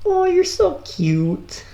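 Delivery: high, slow cooing; cooing at a pet. Real, not acted. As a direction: coo at the little bird. Talking to captured bird.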